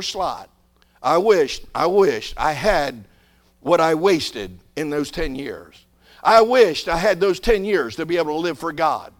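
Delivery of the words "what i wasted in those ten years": ordinary delivery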